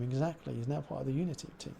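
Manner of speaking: normal talking voice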